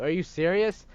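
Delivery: indignant, rising on serious; outraged. Acted. Outraged son.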